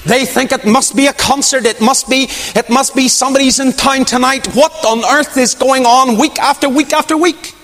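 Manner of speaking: high-pitched, incredulous speculation; worked-up mock bewilderment; baffled onlookers. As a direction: voice the puzzled locals, pitched well up. Acting out baffled townspeople speculating.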